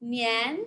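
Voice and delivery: "nian" sliding upward; rising tone. Shown as a demonstration. Teacher combining new and year, rising tone. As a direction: raise "nian" like a question. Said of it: "That tone goes up".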